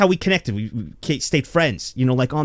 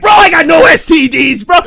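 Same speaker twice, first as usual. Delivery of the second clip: loud, high, hyped-up crowing; jubilant shout; 8 semitones higher, 8 dB louder than usual. Retold or imitated. Little Joey celebrating he is clean, jubilant shout. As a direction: shout it like a pumped-up bro. Revived body part celebrating, acted out.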